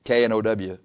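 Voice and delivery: ordinary talk, nothing marked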